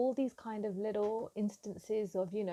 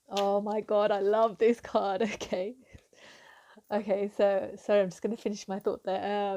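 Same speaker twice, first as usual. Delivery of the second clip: loud, excited outburst breaking into a laugh; real giggly excitement; 7 dB louder than usual. Real, not acted. Tarot reader thrilled by the card she drew, giggly and excited. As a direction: gush loudly, laughing with delight. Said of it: "I've got this excited energy".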